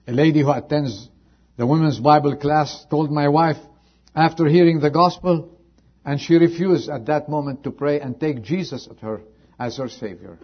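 loud, high preacher's call; fervent pulpit telling; preaching at full force. Acted. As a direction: lift the story to the rafters. Preacher telling story loudly.

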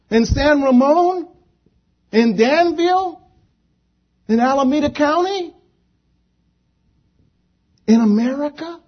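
high-pitched, exasperated preacher's plea; desperate pleading. Retold or imitated. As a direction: cry out to the Lord with insistent, rising questions. Acts believers' desperate complaint to the Lord.

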